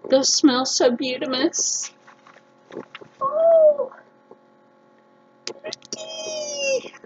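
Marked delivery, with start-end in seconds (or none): 3.2-6.9 cooing. Real, not acted. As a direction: croon over the bath bomb. Delighted with products.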